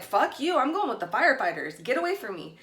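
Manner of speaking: acted-out indignant brush-off; retold angry defiance. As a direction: tell them off, punching the first words. Retold telling off the cops.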